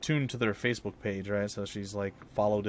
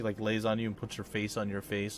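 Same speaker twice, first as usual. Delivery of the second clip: normal talking voice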